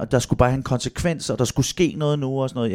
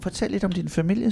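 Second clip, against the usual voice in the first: higher, softer coaxing question; lightly voiced therapist; 5 semitones higher, 7 dB quieter than usual. Therapist to nine-year-old in family therapy, lightly voiced. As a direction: gently imitate a therapist prompting a child. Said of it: "så siger terapeuten til Valdemar".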